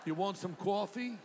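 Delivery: mock East Coast vowels on coffee; hammed-up East Coast accent. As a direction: ask it like an East Coast local. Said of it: "Or East Coast".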